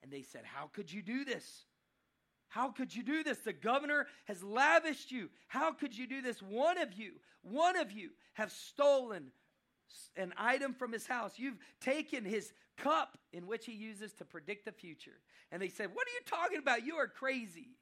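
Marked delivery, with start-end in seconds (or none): 0.4-15.2 loud, high-pitched outraged accusing; indignant accusation. Acted. Warriors accusing the brothers of stealing the cup, indignant and loud. 15.9-17.8 high, loud, incredulous protest; outraged denial. Acted. Brothers answering the warriors' accusation, loud outraged disbelief.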